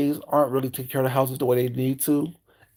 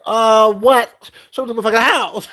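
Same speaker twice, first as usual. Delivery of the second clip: loud, higher, bossy outburst; mock angry demand; 7 semitones higher, 7 dB louder than usual. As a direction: storm in with attitude. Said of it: "I come in with attitude".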